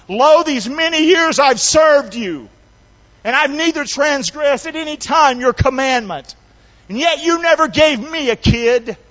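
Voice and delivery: high, loud complaint to dad; acted resentful son airing his grievances; indignant elder brother. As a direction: build a grievance, speeding up as it pours out. Angry brother complains to father.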